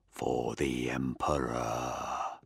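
very slow, low, soft menace; low, slow apparition's whisper. A narrator's reading. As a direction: draw it out in a hushed, low voice. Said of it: "it whispered".

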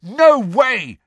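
high, loud refusal; yell. A narrator's reading. Harry refuses Hermione's plea to tell, yelling. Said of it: "yelled Harry".

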